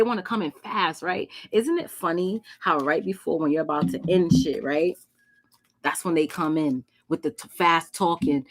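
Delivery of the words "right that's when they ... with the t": usual speaking voice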